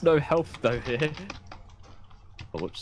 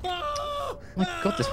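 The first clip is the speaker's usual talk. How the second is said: phobic panic; 4 dB quieter, much slower than usual. Real, not acted. Panicked outburst in game chaos.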